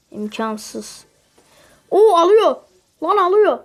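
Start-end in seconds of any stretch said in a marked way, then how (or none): 1.9-3.6 high, loud sudden alarm; startled cry at the screen. Real, not acted. Enemy suddenly taking him down.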